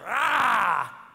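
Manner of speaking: put-on short loud "Ah!" to scare the kid; playful growl at a toddler; dad's pretend growl; mock growl. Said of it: "and I go".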